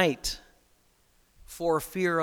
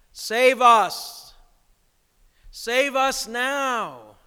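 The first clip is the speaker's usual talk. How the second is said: raised, higher cry with long drawn-out words; imitated crowd's call; 6 semitones higher, 5 dB louder, much slower than usual. Palm Sunday crowd calling to Jesus, drawn-out imitated cry. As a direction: call out like a crowd pleading for rescue. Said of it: "to respond to the call".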